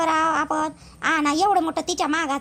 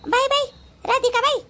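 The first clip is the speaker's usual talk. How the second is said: high call to someone out of sight; calling her sister-in-law to come over; 8 semitones higher than usual. Acted. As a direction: call her name up high across the yard.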